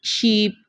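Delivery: sheep, ee held for teaching; long ee in sheep. Shown as a demonstration. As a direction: stretch the ee of sheep. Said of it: "ये double ई की जो voice है".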